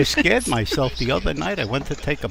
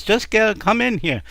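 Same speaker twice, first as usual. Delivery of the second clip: raised-pitch impatient husband; exasperated insisting; 9 semitones higher, 4 dB louder than usual. Acted. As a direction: order her over, exasperated. Husband insisting in acted quarrel.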